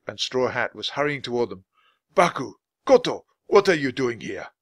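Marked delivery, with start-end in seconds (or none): 2.1-4.5 calling out. A narrator's reading. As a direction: call again, more insistent. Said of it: "he called out to Jack and Annie".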